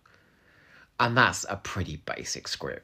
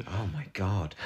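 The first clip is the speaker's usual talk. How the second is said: normal talking voice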